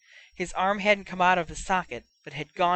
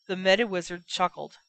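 ordinary delivery, nothing marked

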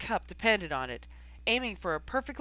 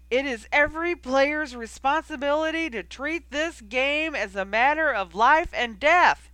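furious shout; 7 semitones higher, 9 dB louder than usual. A narrator's reading. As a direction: rail at her, every word hammered. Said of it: "he shouted".

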